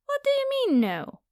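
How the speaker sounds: outraged that prey refuses; indignant disbelief. Acted. Vampire defied by the human, high indignant snap. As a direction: pitch jumps high, then drops cold.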